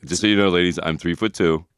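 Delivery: ordinary talk, nothing marked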